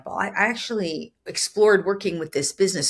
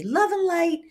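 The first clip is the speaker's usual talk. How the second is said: airy love and light; 11 semitones higher than usual. Retold or imitated. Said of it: "another form of energy".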